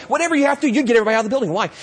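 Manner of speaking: usual speaking voice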